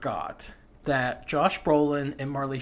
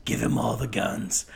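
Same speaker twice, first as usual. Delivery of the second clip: Michael Biehn; 5 semitones lower than usual. Retold or imitated. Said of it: "Michael Bihn's like".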